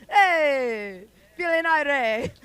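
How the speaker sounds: put-on loud, high Jamaican-accented call. Playing with her Jamaican accent for the crowd, loud and high. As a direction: shout it out with a Jamaican lilt. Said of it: "a little bit of an accent".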